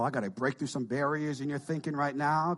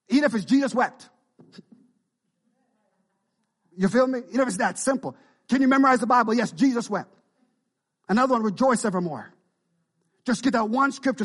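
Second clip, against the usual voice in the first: loud, high preacher's climb; fiery pulpit preaching; full-force preaching; 7 semitones higher, 7 dB louder than usual. Real, not acted. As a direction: drive it home to the congregation.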